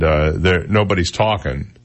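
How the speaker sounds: ordinary talk, nothing marked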